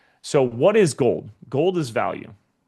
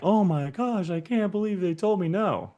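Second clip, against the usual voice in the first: high mock-devastated gasp; mock dismay; 8 semitones higher than usual. Coach mocks a giver devastated by rejection, high mock dismay. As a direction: ham up being crushed by a no.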